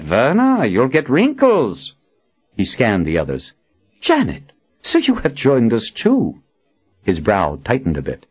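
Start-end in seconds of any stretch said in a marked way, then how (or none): 0.0-1.9 put-on slow, teasing old prophet; teasing man. Nathan teasing. 4.0-6.4 higher, jovial confident man. Acted. Nathan greets.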